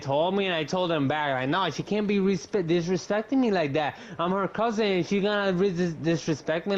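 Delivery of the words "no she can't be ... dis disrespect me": higher, indignant outburst; retold heated complaint. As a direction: sound offended and worked up. Retold angry complaint about cousin.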